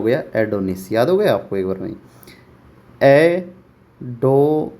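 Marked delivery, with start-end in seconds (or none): 3.0-4.7 एडो stretched very slowly and cut off; teacher starting to sound out एडो. Shown as a demonstration. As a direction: begin एडो slowly, then stop. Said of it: "sounding out the word".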